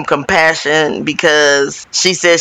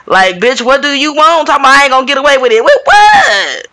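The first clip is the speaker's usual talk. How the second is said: higher and louder, ending on a yelled With what; mock outrage at being pushed; exasperated shout; 5 semitones higher, 3 dB louder, faster than usual. Voicing Kathy's exasperated outburst.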